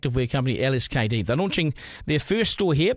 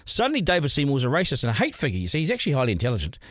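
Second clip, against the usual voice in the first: usual speaking voice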